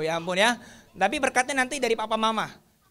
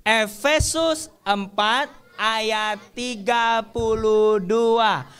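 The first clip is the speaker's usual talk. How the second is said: slow recitation; much slower than usual. Acted. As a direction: lead the class word by word. Leads children reciting verse.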